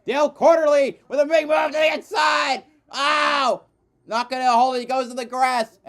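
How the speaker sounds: high, loud shouted play-by-play; excited race call. Real, not acted. Commentator calling a car sliding to the grass, excited shout. Live call of a sudden move.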